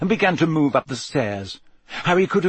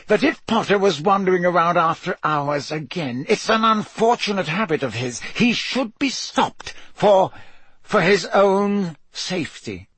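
forced calm; 6 semitones higher than usual. A narrator's reading. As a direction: keep it even through gritted teeth. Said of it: "in a voice of forced calm".

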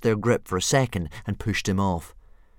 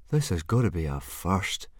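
drawl. Acted. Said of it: "drawled Fishlegs".

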